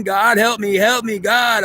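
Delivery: high-pitched imitation of a tearful plea; mock crying prayer. Goddess crying and praying to God for help, mock pleading. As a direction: act out a desperate, drawn-out cry to God. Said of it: "Why she's crying and praying".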